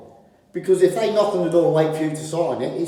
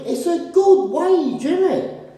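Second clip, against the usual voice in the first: ironic; 13 semitones higher, slower than usual. Real, not acted. Ironic comment on the wage.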